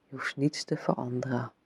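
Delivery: ordinary talk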